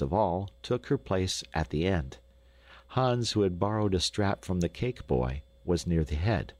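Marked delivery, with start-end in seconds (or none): none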